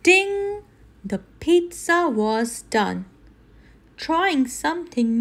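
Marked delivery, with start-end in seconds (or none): none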